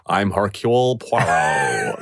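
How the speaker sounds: slow, drawn-out grand self-introduction; imagined Orson Welles. Pretend. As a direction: imitate Orson Welles announcing himself, stretching the name. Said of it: "was he probably like".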